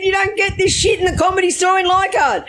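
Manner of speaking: high shouted laughing praise. Retold or imitated. Friend at breakfast shouting his delight, high and laughing. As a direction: yell it through laughter. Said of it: "He said".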